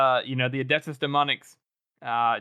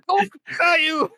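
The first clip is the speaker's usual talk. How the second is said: much higher and louder, accusing; mock outraged shout; 7 dB louder, slower than usual. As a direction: hurl the name like an accusation. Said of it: "he's like".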